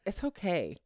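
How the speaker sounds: soft, calm it's okay; imitating her son soothing her; calm gaslighter voice. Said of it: "you say these things in a way where it's very calm".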